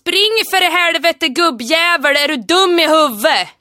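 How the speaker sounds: loud, slow, rough Hälsinge dialect yell; Hälsinge dialect shout. Shown as a demonstration. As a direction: bellow it like an angry Järvsö farmer. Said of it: "imitera den här meningen som jag säger nu". (hälsingemål, argt vrål)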